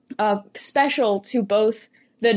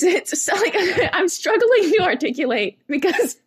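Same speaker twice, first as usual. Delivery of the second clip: laughing; 6 semitones higher, 3 dB louder than usual. Real, not acted. Laughing while explaining.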